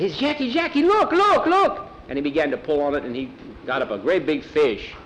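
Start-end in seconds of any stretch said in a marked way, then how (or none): 0.2-1.9 very high, loud excited cries. A narrator's reading. Juan with a fish on his line, very excited. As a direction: squeal it out, bursting to show someone. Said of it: "Juan was very excited".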